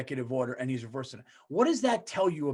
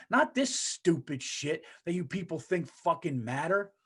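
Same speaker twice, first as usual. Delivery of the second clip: ordinary talk, nothing marked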